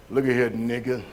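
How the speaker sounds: low, menacing master's threat; voicing the slave master. Preacher voicing the slave master's threat, low and menacing. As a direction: growl it down low like the cruel master.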